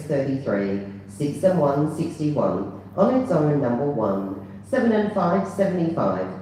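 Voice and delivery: ordinary talk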